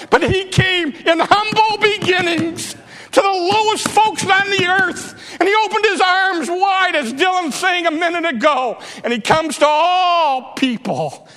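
high-pitched, driving preacher's climax; impassioned preaching. Real, not acted. Preacher proclaiming the humble king to the congregation, impassioned. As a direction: preach it up high with full fervour.

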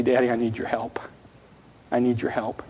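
put-on hushed child's whisper on Daddy's lap; whispered plea. A child on Daddy's lap whispering for help, hushed whisper. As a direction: breathe it like a scared child.

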